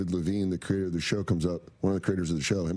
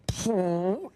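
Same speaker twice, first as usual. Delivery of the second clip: imitated fart noise; 9 semitones higher than usual. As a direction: pop out a raspberry fart. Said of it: "looking at Damien going".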